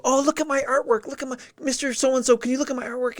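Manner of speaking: put-on high, bright-eyed kid begging to be seen; eager kid voice. Kid asks teacher to look at his art, high and eager. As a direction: play the kid pleading for attention.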